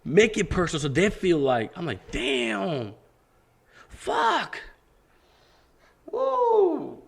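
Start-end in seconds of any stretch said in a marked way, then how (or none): 2.1-7.0 much higher, astonished exclamations; stunned. Retold or imitated. Realizing the manager's advice, high astonished swears. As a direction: let it dawn on you, climbing high.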